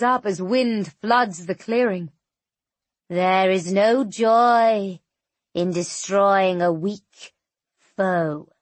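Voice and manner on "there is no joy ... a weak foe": slow, scornful and lofty; slow djinn voice. Acted. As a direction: dismiss the foe with contempt. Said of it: "djinn voice continues".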